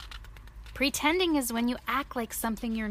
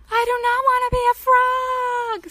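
high, loud wailing protest; panicked cry; 10 dB louder than usual. Acted. Gerald panicking at turning into a frog, wailing cry. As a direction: wail it out in panic. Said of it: "Gerald's not happy. He's sweating".

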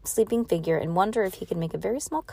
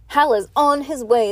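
higher, louder announcement; raised, projecting voice; 6 semitones higher, 5 dB louder than usual. A narrator's reading. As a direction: call the news out to the room. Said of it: "the nurse announced".

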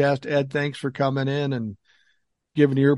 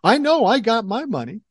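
indignant scammer; 7 semitones higher, 4 dB louder than usual. Retold or imitated. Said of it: "they say".

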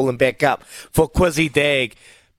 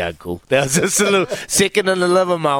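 usual speaking voice

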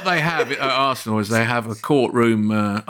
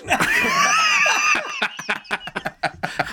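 big laugh. Real, not acted. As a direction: crack up at the punchline. Bursts out laughing at punchline.

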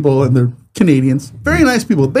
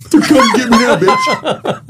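mock villain taunt; 14 semitones higher, much slower than usual.

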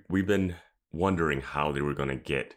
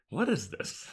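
high confused squeak; 9 semitones higher, 4 dB quieter than usual. Retold or imitated. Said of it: "I was like".

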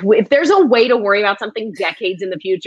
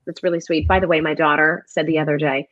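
usual speaking voice

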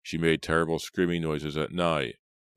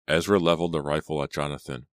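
plain reading voice

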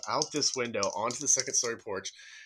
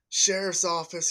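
loud, higher, slow call of "Sheriff's office."; scary deputy voice; 8 semitones higher, 5 dB louder, slower than usual. Retold or imitated. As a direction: bark "Sheriff's office." like a cop calling into the house. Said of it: "this loud, like, scary, deep, hardcore voice".

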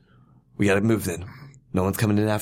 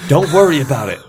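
mock menacing; 7 semitones higher, 8 dB louder than usual. Captor snaps at hostage.

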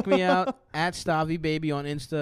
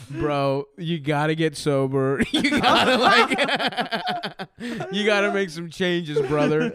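loud laughing bro pep talk; frat bro; 3 semitones higher, 5 dB louder, slower than usual. Retold or imitated. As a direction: bro telling himself to get sober. Dream self lecturing, laughing.

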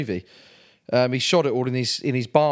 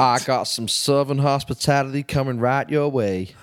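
hammy Southern US drawl; put-on American accent. Guest offering hosts Southern hospitality, mock Southern US accent. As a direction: play up a fake Texan accent. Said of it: "that stupid accent".

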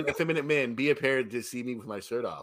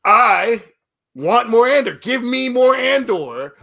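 loud, drawn-out cry for more Andor; loud plea; 9 semitones higher, 12 dB louder, slower than usual. Real, not acted. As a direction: beg for more Andor at full volume. Pleading loudly for more show.

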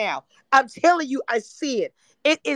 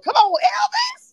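very high squealed call; hysterical hilarity; 3 dB louder than usual. Real, not acted. Host teasing crying [name] as [name], hilarious squeal. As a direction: squeal it, cracking up at your joke. Cracking up at own [name] joke.

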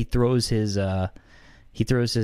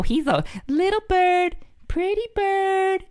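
high slow parrot talk; slower than usual. Acted. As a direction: coo it like a parrot. Cooing to the bird.

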